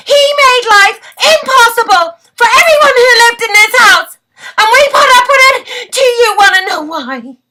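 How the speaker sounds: high, very loud outburst close to crying; anguished shout. Acted. Wife bursts out about life with her cruel husband, anguished shouting. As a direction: cry it out at full force, pitch soaring.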